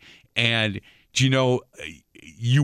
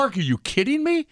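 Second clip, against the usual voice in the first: much higher retold incredulity; setting up the punchline to a bowling buddy, hammed-up disbelief; mock disbelief; 14 semitones higher than usual. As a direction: act stunned for the joke.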